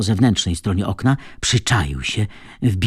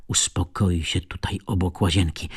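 plain reading voice

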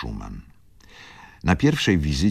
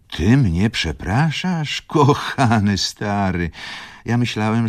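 high, slow, loud disbelief; astonished; 8 semitones higher, 5 dB louder, slower than usual. A narrator's reading. Wokulski answers his old friend's apology, astonished. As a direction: draw it out high, incredulous.

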